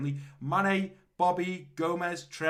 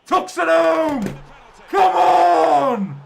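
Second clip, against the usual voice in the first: goal celebration shout; 12 semitones higher, 13 dB louder, much slower than usual. Real, not acted. Penalty scored.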